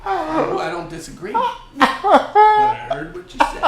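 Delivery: high, loud, laughing protest; laughing tease. Real, not acted. Host teasing Cody about his crude joke, laughing. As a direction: laugh through it, teasing him.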